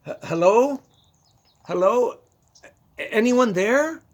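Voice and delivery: loud, hesitant call into an empty room. Retold or imitated. Drunk man calling into a strange lit room, loud and wary. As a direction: call out warily, half-afraid of who answers.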